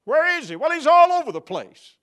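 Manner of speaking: high, loud joyful proclamation; triumphant exclamation. Real, not acted. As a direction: proclaim it with delight.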